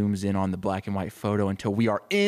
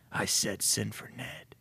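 soft, slow, firm order; hushed chief voice; grizzled police chief; 7 dB quieter than usual. Acted. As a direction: quietly insist as a grizzled cop. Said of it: "he's like, the deep, grizzled police chief".

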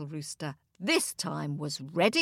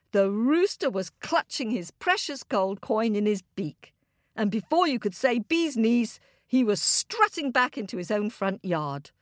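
ordinary delivery

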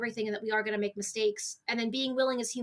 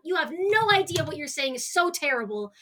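ordinary talk

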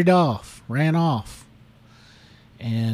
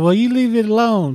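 higher, louder telling off; scolding; 9 semitones higher, 5 dB louder than usual. Retold or imitated. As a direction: scold him to leave it be. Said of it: "she got on to him".

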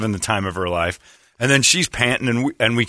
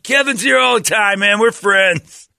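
high-pitched imitated shout; homeless man shouting; 12 semitones higher, 4 dB louder than usual. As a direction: call out loudly, imitating a homeless guy vouching for a regular. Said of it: "homeless guy shouts out his name".

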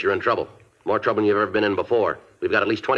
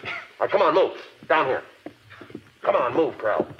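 high, raised commands; barked orders; 5 semitones higher than usual. Acted. Detective herds the suspect down the hall, raised and high. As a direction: herd him along.

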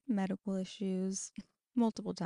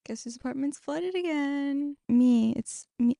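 exasperated; 6 semitones higher, 7 dB louder, slower than usual. Real, not acted. Flooded again, exasperated reveal.

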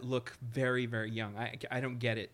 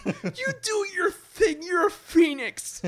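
hammed-up rousing pep talk; 10 dB louder, slower than usual. Retelling being moved by trailer.

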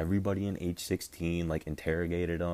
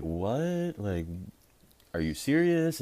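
high, incredulous what are you serious; shocked disbelief; 5 semitones higher, slower than usual. Retold or imitated. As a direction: retell the shock with a high, stunned voice. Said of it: "we're all just like".

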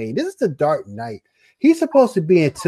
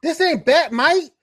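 insistent; 8 semitones higher than usual. Real, not acted. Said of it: "I need some bass".

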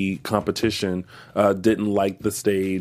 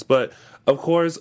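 usual speaking voice

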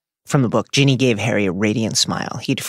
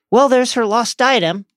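high, lilting character voice; voiced Luna; 11 semitones higher, 4 dB louder than usual. Luna answers Harry's question about the diadem, in a high character voice. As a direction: pitch it well up, as a chirpy book character.

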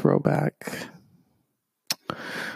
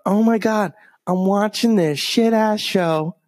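higher-pitched mock dismay; retold shock; 8 semitones higher than usual. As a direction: voice your appalled reaction, pitched up. Retelling own shocked thought.

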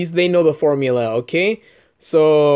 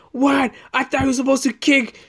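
retold moviegoer; 9 semitones higher than usual.

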